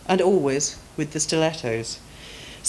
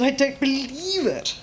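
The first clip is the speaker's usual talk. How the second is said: posh duchess voice; 9 semitones higher than usual. Acted. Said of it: "becomes a duchess once more".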